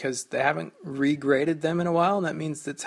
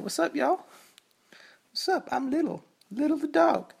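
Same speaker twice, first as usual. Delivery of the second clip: high cartoon voice of the dog; 12 semitones higher than usual. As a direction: speak for the dog in a squeaky voice. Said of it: "but she said".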